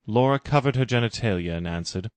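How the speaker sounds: ordinary delivery, nothing marked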